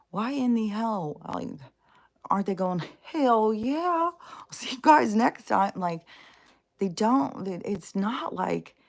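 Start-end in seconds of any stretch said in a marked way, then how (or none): 3.0-5.7 high, loud mock-joyful farewell; gleeful believer heading to heaven; mock cheerful believer. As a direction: sing out a carefree goodbye to the world. Mock believer cheerfully leaving life.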